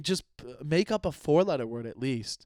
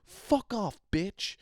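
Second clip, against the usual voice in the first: much higher hammed-up insult; mock nasty yell; 8 semitones higher, much slower than usual. Bad swearing example to mom, hammed-up insult. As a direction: bark it like a cartoon bully. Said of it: "or if you're like".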